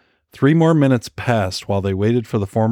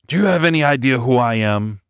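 indignant growl, pitched up; Murray growling his outrage at the intruders; 3 semitones higher than usual. A narrator's reading. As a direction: snarl it as a threat of rank. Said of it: "Murray growled".

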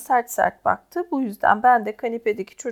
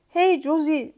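mild, higher-pitched call; shouting; 5 semitones higher, slower than usual. A narrator's reading. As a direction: hail someone with a lightly acted shout. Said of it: "diye bağırdı Scrub". (hafifçe bağırarak)

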